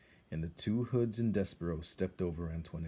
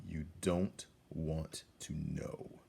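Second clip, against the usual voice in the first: slow, low whisper; hooded guard giving grim news; deep, slow guard voice; 3 semitones lower, 6 dB quieter, much slower than usual. Acted. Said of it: "His voice was deep and slow".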